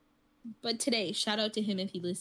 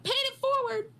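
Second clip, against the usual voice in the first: much higher put-on voice; imitating coworker; 14 semitones higher than usual. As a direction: mimic the coworker's odd delivery. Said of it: "he speaks a different language".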